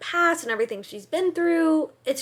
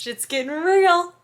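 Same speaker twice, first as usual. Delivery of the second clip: dramatic; 6 semitones higher, 7 dB louder than usual. Pretend. Dramatic announcement.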